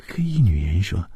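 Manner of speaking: usual speaking voice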